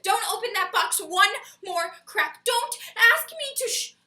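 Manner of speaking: loud, high theatrical alarm; comic panic. Acted. Partner begging someone not to open the box, comic panic. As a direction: frantically stop them, hammering the stressed words.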